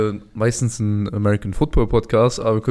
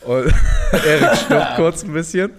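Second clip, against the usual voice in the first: laughing; 4 semitones higher, 4 dB louder, slower than usual. Real, not acted. Laughing about Erik's spicy food.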